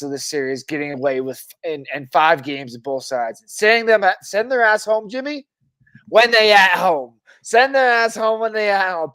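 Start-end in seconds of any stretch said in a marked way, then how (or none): 3.5-9.1 gloating. Real, not acted. Gloating about the win.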